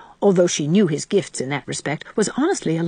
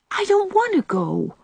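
put-on much higher little girl's protest; 13 semitones higher than usual. A narrator's reading. Little sister refusing to skip church, high protest. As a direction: whine a small girl's refusal. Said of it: "protested Dora".

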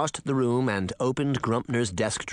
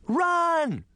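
cry out. Acted. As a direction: yell the warning to flee. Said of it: "she cried".

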